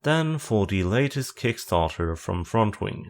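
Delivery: ordinary talk, nothing marked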